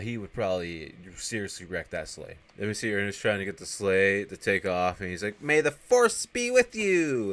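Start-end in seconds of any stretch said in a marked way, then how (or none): 5.4-7.3 high, loud, drawn-out call; mock battle cry. Ernest urging the sleigh to take off, hammed-up shout. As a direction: belt it out slowly like a goofy rallying cry.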